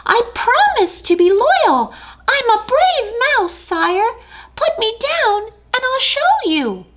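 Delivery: eager tiny mouse; brave little mouse. Acted. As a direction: puff up small and bold. Mouse voiced in story.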